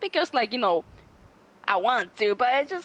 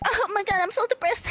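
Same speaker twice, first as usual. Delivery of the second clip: much higher dramatic mock whine; mocking younger self; 11 semitones higher than usual. Mocking her younger attention-seeking self, high whine. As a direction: whine it like a teen drama queen. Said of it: "be like".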